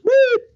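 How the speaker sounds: short high siren beep; quick high-pitched beep imitation. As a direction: chirp it like a cop siren.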